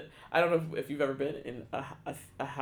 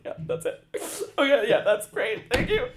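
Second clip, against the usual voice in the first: loud, high, laughing mock-blubber; retold bawling gratitude; 9 semitones higher, 11 dB louder than usual. Imitating herself bawling thanks on the phone, overwrought. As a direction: gush agreement through laughter, hammed-up.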